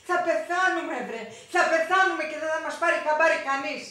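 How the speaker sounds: loud, high lament; exasperated loud complaint; despairing outburst. Acted. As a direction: wail that they'll die forgotten here. Desperate complaint to crying child.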